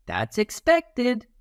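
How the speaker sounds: high, slow, sing-song "that's expected"; cheerful high approval. Shown as a demonstration. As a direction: chime it in like a cartoon helper.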